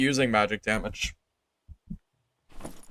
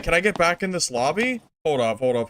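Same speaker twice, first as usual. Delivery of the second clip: ordinary delivery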